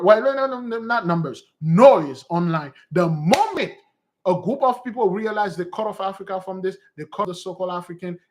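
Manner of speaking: usual speaking voice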